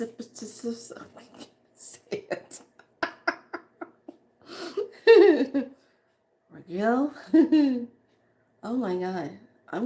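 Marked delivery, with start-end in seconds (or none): 0.0-2.6 slow, breathy giving-up whisper; overwhelmed hushed aside. Real, not acted. Can't pronounce card name. 4.5-5.7 high, loud laugh near crying; overwhelmed crying laugh; tearful laugh. Real, not acted.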